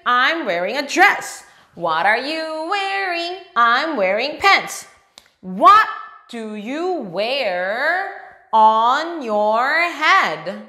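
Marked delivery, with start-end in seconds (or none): 5.4-10.6 slow and clear. Shown as a demonstration. Teacher modelling the question for kids, very slow. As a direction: draw each word out very slowly. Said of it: "try to say it slowly".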